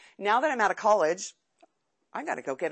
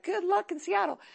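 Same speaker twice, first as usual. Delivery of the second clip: proud parents voice; 11 semitones higher than usual. Retold or imitated.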